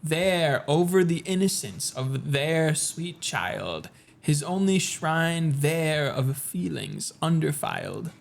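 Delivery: very slow, low poetry reading; Nevermore-style gloomy verse, drawn out; slow, deep recitation. Acted. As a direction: draw each line out deeply and solemnly. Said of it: "That was you just speaking slowly and deeply".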